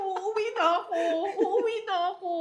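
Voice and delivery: high, laughing mock wail; half-laughing retelling of her own cry. Swimmer retelling her panic, laughing through it. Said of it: "Sabi ko".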